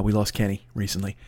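ordinary talk, nothing marked

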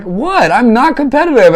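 high, loud imitation of her shock; retold outraged disbelief; indignant protest. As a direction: mimic an affronted denial, voice shooting up. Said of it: "she was shocked and kind of indignant".

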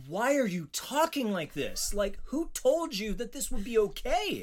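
high-pitched, exasperated questioning; incredulous challenge. Retold or imitated. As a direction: demand an explanation in disbelief. Acts out challenge to actor.

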